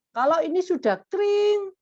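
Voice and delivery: pitched high, rising into the ring; high demonstrative ring on the last word; imitated timer ring. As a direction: call it out high like a timer going off. Parent's warning, imitated timer ring.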